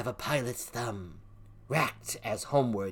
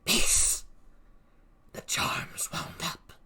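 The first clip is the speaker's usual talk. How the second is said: whispered hush; whispered warning; slower than usual. Acted. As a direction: hush the sisters, unvoiced. Witch hushes the coven.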